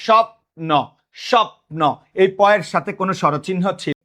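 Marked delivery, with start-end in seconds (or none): 0.1-0.7 স্বপ্ন as "shop-no", p and n together; no vowel between p and n in স্বপ্ন; cluster in স্বপ্ন. Shown as a demonstration. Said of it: "উচ্চারণ করো তো". 1.3-1.8 স্বপ্ন as "shop-no", p and n together; no vowel between p and n in স্বপ্ন; cluster in স্বপ্ন. Shown as a demonstration. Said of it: "উচ্চারণ করো তো".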